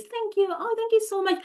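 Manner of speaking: very high, gushing thanks; gushing grateful child. Retold or imitated. Imitating a grateful child thanking a parent. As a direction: squeal thanks like a delighted child.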